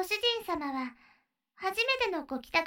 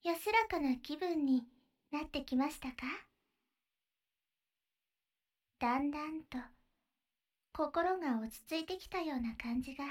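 guided breathing; 3 semitones lower, 7 dB quieter than usual. Acted. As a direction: lead the breathing gently, half whispered. Leads a breathing exercise.

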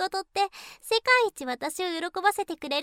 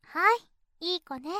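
ordinary talk, nothing marked

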